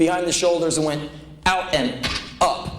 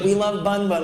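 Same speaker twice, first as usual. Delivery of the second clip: lightly voiced child, higher pitch; imitated kid; 6 semitones higher than usual. Kid echoes mother about rabbit, lightly mocked child voice. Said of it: "And the kid's like".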